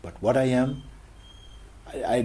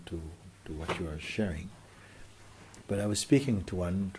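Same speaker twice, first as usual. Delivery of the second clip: ordinary delivery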